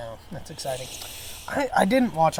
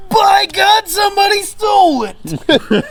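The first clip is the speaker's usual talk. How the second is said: high, loud mock outrage; 9 dB louder, slower than usual. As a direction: bellow it, hammed-up. Mock outrage about missing shirt.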